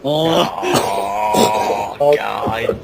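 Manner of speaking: loud, sobbing groans; hammed-up cry of despair; mock anguish. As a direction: fake a dramatic breakdown. Reacting to the wrestler's betrayal.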